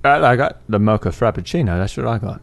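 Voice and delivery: light Cockney film star voice; Michael Caine voice. Pretend. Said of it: "let's see how you do, your Michael Caine".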